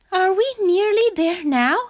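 high, loud, worn-out frog voice; high, out-of-breath little frog; panting. A narrator's reading. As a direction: ask as if puffed from walking, high-pitched. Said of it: "panted Frog".

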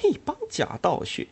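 high scornful jab; disgusted. Acted. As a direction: sneer at the hypocrites. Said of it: "他厌恶地说着".

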